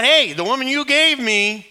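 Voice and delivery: high, loud, indignant whine; blaming Adam. Acted. Adam throwing blame back at God, high and loud.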